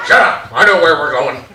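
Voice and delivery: dad barking back at mom in the car; dad snapping. Retold or imitated. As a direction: shut her down like a stubborn driver.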